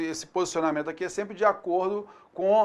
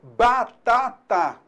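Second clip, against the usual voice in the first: "batata" very slowly, three separate syllables; louder, deliberate "batata"; 2 semitones higher, 4 dB louder, much slower than usual. Shown as a demonstration. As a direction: break "batata" into ba, ta, ta. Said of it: "quando eu falo batata".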